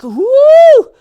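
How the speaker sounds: high, loud celebratory whoop; excited woo. Acted. Volunteer whooping at the mom's pick, high and loud. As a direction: let out a joyful cheer. Cheering pink choice.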